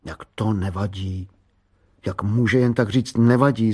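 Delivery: ordinary delivery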